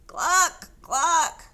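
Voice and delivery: high, loud clucking. A narrator's reading. Pig clucking loudly like a chicken. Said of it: "said Pig".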